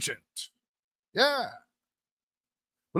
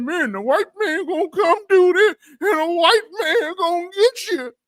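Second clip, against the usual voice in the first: higher, whiny mock panic; mock fearful whining; 10 semitones higher, 4 dB louder than usual. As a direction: wail it like a scared preacher warning the flock. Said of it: "They always talking about".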